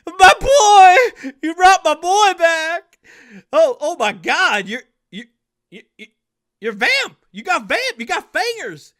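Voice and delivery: high, loud tearful cries of joy, laughing through tears; a father weeping and shouting with joy at his son's return; overjoyed sobbing. Acted. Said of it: "Dion Senior just falls to his knees, start crying".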